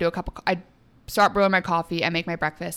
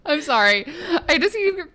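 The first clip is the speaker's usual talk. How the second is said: giggling through the apology; laughing; 8 semitones higher than usual. Real, not acted. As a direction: laugh while speaking. Still laughing after eye rub.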